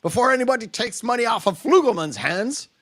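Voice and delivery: fast, high ranting producer; fly off the handle, quick and high; angry outburst. Retold or imitated. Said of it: "goes just off the handle".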